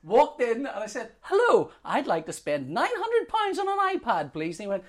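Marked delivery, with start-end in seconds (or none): 1.2-4.6 high, emphatic customer greeting; eager customer. Retold or imitated. Customer cheerily announcing a big purchase to shop assistant, eager.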